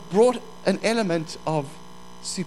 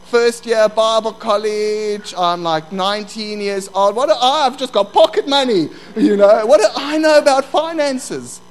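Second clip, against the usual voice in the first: louder, higher, building exasperation; retold nervous outburst, pitched up; flustered protest; 4 semitones higher, 7 dB louder than usual. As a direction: protest your ignorance, getting more worked up. Acting his panicked protest, building.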